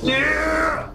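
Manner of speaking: high, drawn-out cheer; triumphant cheer. Real, not acted. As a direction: let out a high victorious cry. Gloating over beaten opponent.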